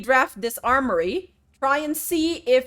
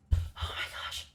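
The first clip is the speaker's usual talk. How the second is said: breathy whispered shock; 14 dB quieter, faster than usual. Real, not acted. Streamer stunned by the game, whispering. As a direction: whisper it in disbelief.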